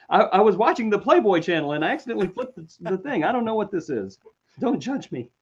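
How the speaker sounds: high-pitched, stammering cover-up; hammed-up embarrassed self-defence; mock flustered excuse. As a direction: make a caught-out excuse in a high voice. Acted excuse, then says was joking.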